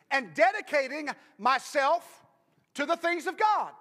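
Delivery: loud, high preaching climax; rousing sermon shout; full-force preaching. Acted. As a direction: preach it hard to the congregation.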